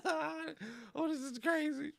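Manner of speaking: laughing through the words, high and breaking; real laughter spilling into the line. Real, not acted. Laughing in disbelief at herself.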